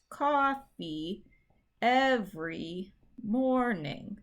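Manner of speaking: COF-fee EV-ery MOR-ning, spaced slowly; slow, separated COFFEE EVERY MORNING, front-stressed; correct stress. Shown as a demonstration. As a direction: lean on the first syllable of coffee, every, morning. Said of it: "that first one is".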